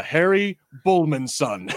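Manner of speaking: slow, grand storyteller reveal; narrating voice. Acted. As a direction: draw out the name like a legend. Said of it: "a narrating thing".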